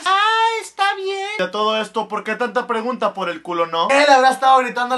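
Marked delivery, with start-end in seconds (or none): 0.0-1.3 put-on much higher little boy; child's voice. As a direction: ask like a curious kid. Child accepts.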